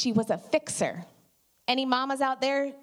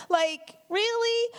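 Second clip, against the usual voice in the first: much higher, slow disbelieving really; incredulous; 11 semitones higher, much slower than usual. Real, not acted. Mom scoffing at pity for her daughter: slow, high incredulous question. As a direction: draw out the disbelief. Mocking people's pity for Kaya.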